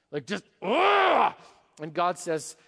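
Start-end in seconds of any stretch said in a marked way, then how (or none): 0.1-1.3 loud, slow, exasperated ugh; a strangled shout of pure fury; frustrated anger. Retold or imitated. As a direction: let the rage burst out in a groan. Miming being torqued with anger.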